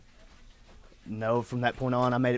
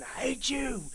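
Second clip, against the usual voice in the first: much higher, strained cry; I hate you at God; 12 semitones higher, 4 dB quieter, slower than usual. Retold or imitated. A desperate man shouting hatred at God, high and strained. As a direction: spit it upward in despair. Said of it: "I'm cursing God and I'm calling him out".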